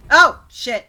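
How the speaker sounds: sudden loud surprised outburst; startled curse. Real, not acted. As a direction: blurt it as the blast hits. Furnace explodes, startled curse.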